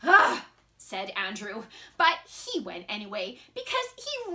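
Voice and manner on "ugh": short loud huff of protest; grudging, disgusted "ugh"; exasperated grunt. Acted.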